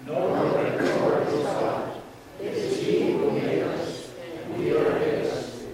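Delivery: usual speaking voice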